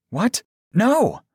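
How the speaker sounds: high, sharp cry of disbelief; shocked gasp. A narrator's reading. Captive appalled at Joseph's words, shocked gasp. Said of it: "I gasped at Joseph's words".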